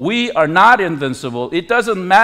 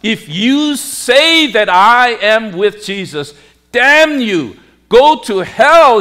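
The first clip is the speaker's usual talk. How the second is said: loud, raised acted curse; heated retold cursing, higher and louder; cursing everyone; 5 semitones higher, 6 dB louder than usual. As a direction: curse your accusers as Peter, building in force. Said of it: "he started cursing to himself and everyone else".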